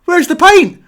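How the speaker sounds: high loud call of Ho, ho, where's the pint; imagined call out. Retold or imitated. Customer calling to waitress who walked off, loud and high. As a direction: shout across the room for the pint, high and loud. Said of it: "before I could say to her".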